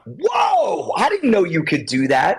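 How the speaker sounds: a high whoa, then amazed; impressed surprise. Retold or imitated. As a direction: sound truly wowed by a friend's work. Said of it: "and they go".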